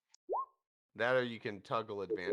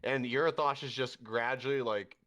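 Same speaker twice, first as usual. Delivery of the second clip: ordinary talk, nothing marked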